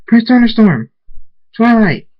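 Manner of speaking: two high, loud calls; screamed names. A narrator's reading. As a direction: scream out to both rescuers. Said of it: "Princess Cadance's voice screams".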